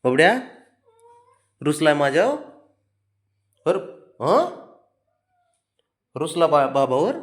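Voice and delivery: put-on high, loud coaxing baby talk to a sulking pet.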